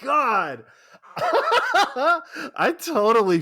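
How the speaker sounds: high, loud delighted laughter through words; laughing. Real, not acted. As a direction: laugh while remembering. Childhood memory suddenly recalled.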